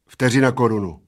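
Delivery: loud, ready answer; fired off. A narrator's reading. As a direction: fire it straight back. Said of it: "vypálil plešatec". (vypálit bez váhání)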